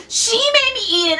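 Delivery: high, whining blame; whiny Adam. Retold or imitated. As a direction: whine like a kid caught out. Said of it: "And Adam's like".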